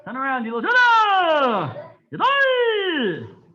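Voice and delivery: high, loud calls with long falling tails; shouted drill commands. Real, not acted. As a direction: bellow each command, stretching the last vowel. Instructor calls out turn and count.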